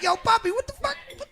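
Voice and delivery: high, fast indignant yell at the super; mock angry complaint. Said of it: "One winter, I'm like".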